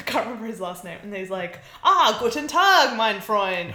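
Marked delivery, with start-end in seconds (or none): 1.8-3.7 loud, hammed-up German greeting; over-excited mock German. Alien greeting Mister German, hammed-up heartiness.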